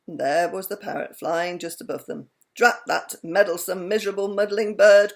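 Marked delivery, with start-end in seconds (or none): 2.5-5.1 loud, exasperated outburst; loud, annoyed cry at a pest. A narrator's reading. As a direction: cry it out, fed up with the bird. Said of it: "cried Mister Wumble".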